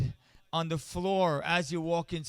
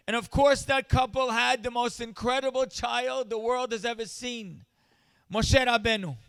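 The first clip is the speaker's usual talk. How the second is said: rising sermon; 5 semitones higher than usual. Acted. Speaker rallying the charity audience, preaching at full force. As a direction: drive the point home to the crowd.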